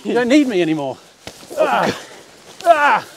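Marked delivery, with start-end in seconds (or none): 1.6-3.1 rising effortful yelps; straining cry. Real, not acted. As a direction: strain as the pipe gives. Straining while pulling pipe.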